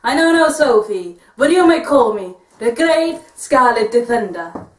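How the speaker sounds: put-on Puss in Boots style Spanish accent. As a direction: heroic swashbuckler in a Spanish accent. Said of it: "is that your Spanish accent?".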